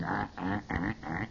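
soft, low repeated buzzing "ah"; imitated dialing noise. Waiting for your number in the booth, imitated phone noise. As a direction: imitate a line ringing in short low grunts.

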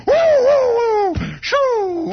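high whooping noise. Retold or imitated. Tire blows and car skids to a stop, high whooping noise.

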